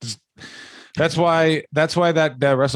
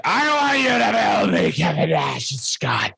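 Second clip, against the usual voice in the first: higher mock Vince McMahon shout; imitating Vince McMahon; 6 semitones higher than usual. Higher mock Vince McMahon shout at Irish scientists. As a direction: bark the order like Vince. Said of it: "and was like".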